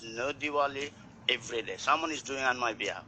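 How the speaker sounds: usual speaking voice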